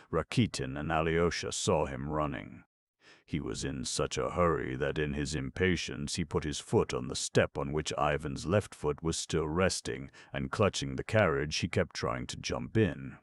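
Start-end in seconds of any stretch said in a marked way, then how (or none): none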